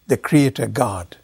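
usual speaking voice

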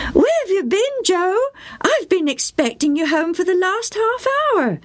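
high, anxious mother's call; a worried mum calling her son. A narrator's reading. Mother meeting Joe in the kitchen, high and anxious. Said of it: "his mother called".

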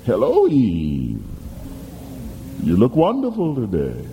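a hint of a silky serpent greeting; smooth serpent voice. Serpent flattering Eve.

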